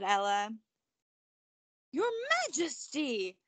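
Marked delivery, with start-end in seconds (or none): 1.9-3.4 high, startled cry of recognition; surprised exclamation. Acted. Courtier recognizing the frog, high surprised exclamation. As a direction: gasp it out in awe. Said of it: "the courtier exclaimed".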